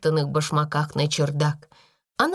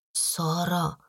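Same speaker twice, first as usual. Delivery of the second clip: soft, hesitant call; timidly hushed; 4 dB quieter than usual. A narrator's reading. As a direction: say the name shyly, slightly frightened. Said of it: "робко сказала она".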